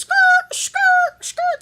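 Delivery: very high, loud mock skid squawks; screeched tyre noise. Teller shooing the man away, screeched tyre-skid sounds. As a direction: screech it like tyres peeling away.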